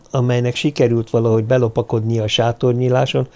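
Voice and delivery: ordinary delivery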